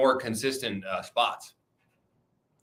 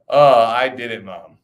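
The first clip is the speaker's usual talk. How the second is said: mock triumph; 10 dB louder, slower than usual. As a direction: playfully announce it to Mom, falling away. Mock boast to Mom, repeated.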